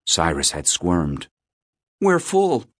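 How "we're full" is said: put-on high-pitched, hurried boy's reply; quick reply. A narrator's reading. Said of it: "he'd said quickly".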